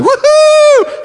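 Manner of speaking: a huge, very high, very loud whoop of joy; joyful whoop. Retold or imitated. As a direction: let out a wild cheer like someone who can suddenly walk. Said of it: "don't you think this guy was like".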